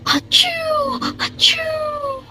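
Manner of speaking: sneezing. Acted. Sleeping lion sneezes in the forest, hammed-up sneeze. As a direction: act out a lion's big sneeze, twice.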